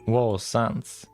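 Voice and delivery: normal talking voice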